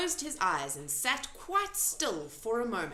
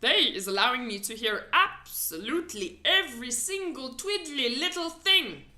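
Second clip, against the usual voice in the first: louder proud giant; BFG voice; 3 semitones higher, 6 dB louder than usual. A narrator's reading. The giant boasting to Sophie about hearing. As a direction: boast cheerfully.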